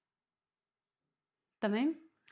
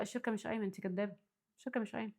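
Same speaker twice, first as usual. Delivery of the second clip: quiet inner critic; 9 dB quieter, faster than usual. Retold or imitated. Voicing her own inner critic.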